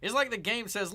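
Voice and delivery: usual speaking voice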